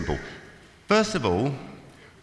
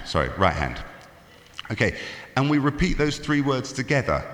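normal talking voice

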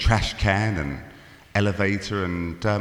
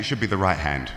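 normal talking voice

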